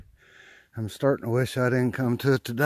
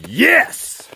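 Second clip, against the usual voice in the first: explosive high, loud cry of victory; triumphant yell; 12 dB louder than usual. Real, not acted. Trapper finding a bobcat in his trap, a real triumphant yell. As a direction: shout it out like the long hunt finally paid off.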